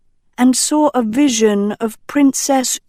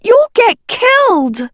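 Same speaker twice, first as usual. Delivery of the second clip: much higher, loud alarmed shout; alarmed cry; 5 dB louder than usual. Acted. Sophie yanking Agatha back from the door, frantic cry. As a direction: stop her with a frantic cry.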